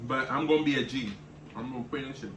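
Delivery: talking through burning mouth; words round a mouthful of spicy food; mouth full of hot food. Real, not acted. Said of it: "My mouth is on fire".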